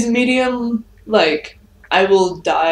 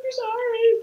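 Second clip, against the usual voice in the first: apologizing through a mouthful of chips; mouth full; slower than usual. Real, not acted. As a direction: talk while chewing. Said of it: "Axis is sitting here eating tortilla chips".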